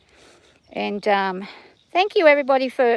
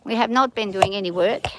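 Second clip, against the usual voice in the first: usual speaking voice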